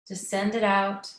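unhurried meditation guidance with long pauses; guided meditation. Acted. Inviting listeners to settle in before the talk, guided meditation. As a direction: ease them inward, leaving space after each phrase.

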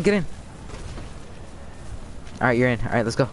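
ordinary delivery

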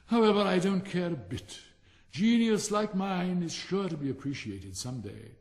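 pompous Rocket. Acted. Vain firework boasting to the silent Dragonfly. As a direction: preen and show off, loving your own voice.

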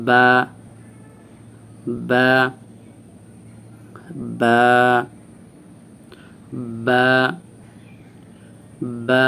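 "বা" from closed lips, drawn out with open mouth; teacher's slow, long "বা"; demonstrated articulation. Shown as a demonstration. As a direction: start "বা" with both lips lightly touching, then open wide. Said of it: "এইভাবে উচ্চারণ করতে হবে".

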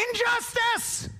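high, drawn-out shout of protest; acted indignant outburst; outraged cry. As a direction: cry it out slowly, pitched very high. Voices the offended inner voice.

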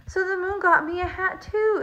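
high-pitched, delighted storybook bear; excited bear, pitched well up; exclaiming. A narrator's reading. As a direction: exclaim it with happy surprise. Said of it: "exclaimed Bear".

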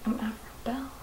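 unvoiced breathy whisper of the name. Wife repeating her character name, in a whisper. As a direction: whisper it sheepishly.

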